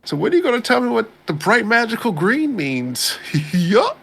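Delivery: high-pitched clueless imitation; mock oblivious questioner. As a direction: blurt it out in a silly high voice. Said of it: "then you just like".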